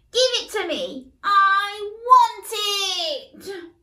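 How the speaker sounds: loud, high whiny demand; demanding shout. Acted. Little brother yells for the toy, loud whiny shout. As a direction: stamp your foot and insist.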